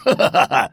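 loud hearty creature laugh; a monster laughing off fear. A narrator's reading. Creature laughs at the boy's question, loud laugh. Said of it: "und lachte".

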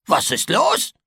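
a hint of a cheeky goblin asking; Zornikel voice. A narrator's reading. Little creature asks the boy, lightly voiced. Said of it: "fragte das Zornikel".

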